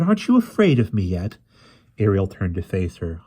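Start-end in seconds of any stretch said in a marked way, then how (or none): 0.0-1.4 high, loud challenge, swooping down at the end; taunting witch. A narrator's reading. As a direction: goad the listener with a theatrical question.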